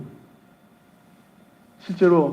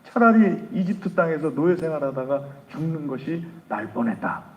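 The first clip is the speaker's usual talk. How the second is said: ordinary talk, nothing marked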